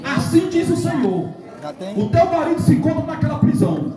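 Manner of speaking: high-pitched retold prophecy; imitating a child's prophetic declaration; young boy prophesying. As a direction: proclaim it high and urgent. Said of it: "um jovenzinho bem pequenininho disse assim".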